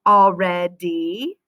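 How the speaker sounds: already said slowly with a rising tune; stretched word. Shown as a demonstration. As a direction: draw out already like a question. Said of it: "did you get".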